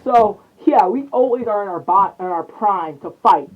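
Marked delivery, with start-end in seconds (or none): none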